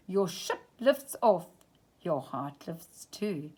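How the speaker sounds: usual speaking voice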